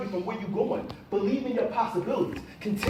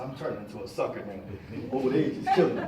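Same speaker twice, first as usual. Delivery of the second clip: mock teary; 9 semitones lower than usual. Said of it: "I got kinda teary-eyed".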